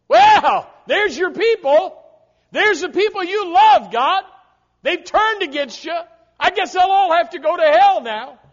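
loud, high gloating devil taunting God; mocking devil. The devil gloating to God over Adam and Eve, loud mocking taunt. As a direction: crow it out like the devil laughing at God.

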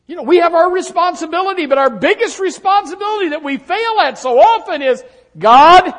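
high, loud pulpit cry; impassioned preaching. Real, not acted. Preacher crying out to the congregation, high and loud. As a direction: lift it to a shout.

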